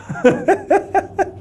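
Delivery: a high burst of laughter. Real, not acted. Teacher laughing after student's marriage question, high burst. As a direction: let out a high, helpless laugh.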